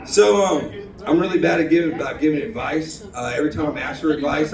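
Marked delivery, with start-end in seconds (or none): none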